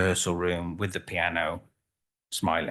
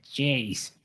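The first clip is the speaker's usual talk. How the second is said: higher-pitched mock Australian exclamation; Australian accent; 5 semitones higher than usual. Ballerina reacts to standing on a lake, imitated Australian accent. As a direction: toss it out in a put-on Australian accent. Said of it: "Sorry about the accent".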